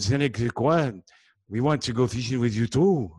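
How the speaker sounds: loud French-Canadian English; Montreal accent. Acted. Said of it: "I was over there in Montreal visiting some friends".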